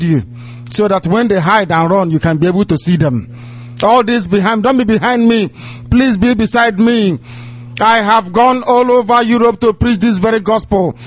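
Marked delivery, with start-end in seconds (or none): 3.8-11.0 preaching. Acted. Preacher pleading with followers.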